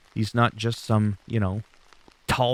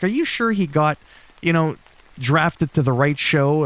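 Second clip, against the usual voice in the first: mock disbelief; 4 semitones higher, 3 dB louder than usual. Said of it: "He's like".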